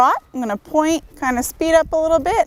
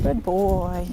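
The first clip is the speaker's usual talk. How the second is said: soft praise; 5 semitones lower, 5 dB quieter than usual. Real, not acted. Praising horse softly during lunging.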